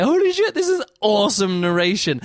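high, loud burst of amazement; excited exclamation. Retold or imitated. Recalling the audiobook, he exclaims in high, loud delight. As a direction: blurt it out, suddenly thrilled. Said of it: "and I was just like".

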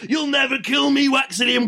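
high, shouted action-movie defiance; hammed-up foe yelling mid-battle; mock villain taunt. Villain defying Waxilium in pretend fight, shouted taunt.

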